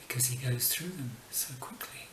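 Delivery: spooky whispered punchline. Acted. Whispering the ghost joke's answer to viewers. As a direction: hush it like a ghost.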